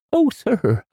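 very high, loud housekeeper's wail; piercing scream. A narrator's reading. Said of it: "a piercing scream".

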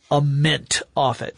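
ordinary talk, nothing marked

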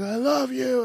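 very high imitated crying wail; screaming through tears. Father and son crying at each other, primal wail. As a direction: wail it at the top of your range. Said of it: "screaming it at each other".

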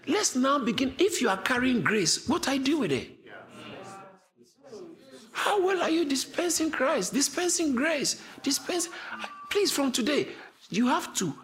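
high, ringing preacher's cry; fiery preaching. Acted. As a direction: preach it out with full fire. Preaching at full force.

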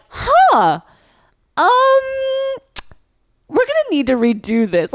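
high, loud, hesitant imitation; taken-aback teacher. Opera teacher reacting to overdone makeup, high mock dismay. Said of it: "looked at me and went".

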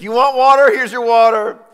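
loud, high angry outburst; ticked off. Retold or imitated. Moses losing his temper at the rock, furious shout. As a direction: snap it out while striking the rock. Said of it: "And Moses just gets ticked".

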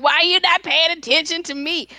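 put-on whining toddler voice; whiny child. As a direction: play the needy kid demanding attention. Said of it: "you're like".

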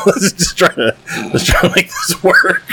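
high, giggly retold line with a laugh at each end; laughing while talking. Real, not acted. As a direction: laugh your way through it. Laughing through own retold quote.

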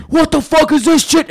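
high, loud furious coach yell; angry coach. Retold or imitated. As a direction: bark it like a raging coach. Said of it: "My coach would be like".

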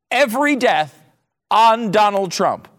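high, exasperated repetition; indignant emphasis. Real, not acted. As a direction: drive each phrase home at high pitch, with pauses.

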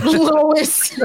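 Lois Griffin. Acted. Podcaster told she sounds like a cartoon wife, impression. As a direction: say the name as the cartoon wife would. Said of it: "You sound like Lois Griffin".

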